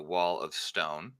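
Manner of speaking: ordinary talk, nothing marked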